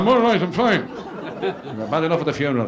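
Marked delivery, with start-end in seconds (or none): 0.0-0.9 put-on a loud, insistent drunk; tipsy uncle waving off the family. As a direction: brush them off, half-cut and stubborn.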